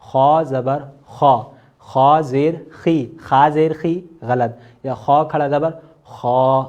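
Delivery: خا said slowly and thick, mouth rounded; full heavy kha. Shown as a demonstration. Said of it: "منہ کو تھوڑا سا اپنے پھلائیں". (پُر خا منہ پھلا کر)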